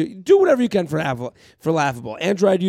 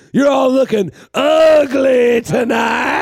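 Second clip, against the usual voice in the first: slow, high taunting shout; wrestling heel shout; 7 semitones higher, 7 dB louder, much slower than usual. Retold or imitated. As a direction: insult the crowd, very slowly. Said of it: "they'd be like".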